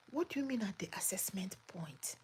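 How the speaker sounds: breathy whisper of a puzzled question; whispered repeat. Real, not acted. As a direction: whisper it back, baffled.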